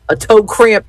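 ordinary delivery

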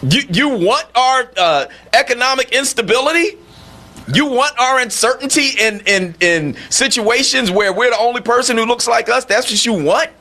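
high, disbelieving questioning; incredulous. Acted. Host asking the pretender if she wants our oppression, incredulous. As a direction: press each question as if it makes no sense.

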